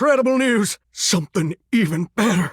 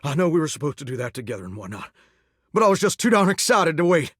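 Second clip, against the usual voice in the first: ordinary talk, nothing marked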